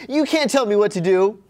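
high, loud put-on protest; defiant mock quote. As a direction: stand up to him as the heroine, hammed-up. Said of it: "She's like".

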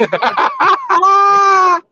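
big high laugh bursting out; loud burst of laughter. Real, not acted. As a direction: crack up loudly at the memory.

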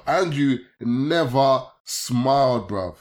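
ordinary delivery

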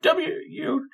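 high squeaky put-on voice, stammering; Mister Stud voice. Hidden character snapping at the player, squeaky put-on voice. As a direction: pitch way up like a cartoon sidekick bickering. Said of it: "a voice that's much lower and quieter going".

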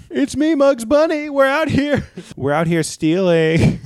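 very high, loud cartoon bunny; Muggs Bunny. Bunny mugger announcing himself to a victim, exuberantly. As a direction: announce yourself in a shrill-pitched cartoon shout. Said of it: "are you at real Muggs Bunny".

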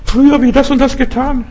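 high, pleading mock prayer; bettelnder Beter. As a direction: imitate someone begging God in a high whine. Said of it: "Du musst nicht immer wieder betteln". (übertrieben flehendes, hohes Bitten)

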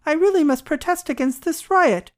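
high-pitched, agitated objection; exclaimed protest. A narrator's reading. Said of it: "exclaimed the doctor".

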